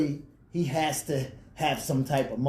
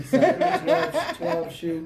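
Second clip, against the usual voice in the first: laughing; 11 semitones higher, 6 dB louder, much slower than usual. Real, not acted. Joking about shoe size.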